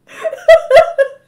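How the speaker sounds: high, loud shriek of laughter; real helpless laughter; squealing laugh. Real, not acted. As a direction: lose it completely. Laughing fit continues.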